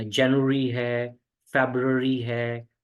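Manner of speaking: each month drawn out slowly for learners; slow teacher's demo of January and February; slow careful month names. Shown as a demonstration. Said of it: "month में भी बहुत सारे लोग गलती करते हैं".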